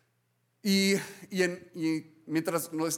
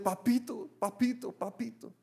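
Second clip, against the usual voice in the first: softer, fading pleading papito; anguished soft plea; 3 semitones higher, 6 dB quieter than usual. Retold or imitated. Jesus before the cross, softly pleading with his Father. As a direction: plead quietly, breaking off. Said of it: "Estaba diciendo".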